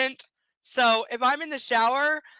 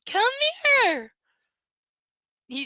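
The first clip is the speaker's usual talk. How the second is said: normal talking voice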